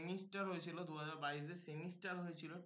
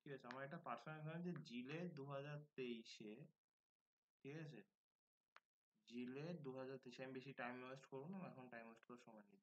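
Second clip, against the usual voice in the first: speaking softly; 2 semitones lower, 6 dB quieter than usual. Real, not acted. Streamer to students on a live stream, speaking softly. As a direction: keep it down, just talking quietly. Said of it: "আমি একটু আস্তে কথা বলছি".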